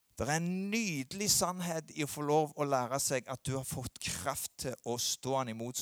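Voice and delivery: usual speaking voice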